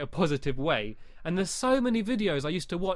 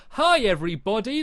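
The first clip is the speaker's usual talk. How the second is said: louder, over-eager YouTuber hello; hammed-up host welcome; mock cheesy greeting; 5 semitones higher, 6 dB louder than usual. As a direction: ham up a fake intro greeting. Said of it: "Don't start your videos going".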